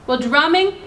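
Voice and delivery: usual speaking voice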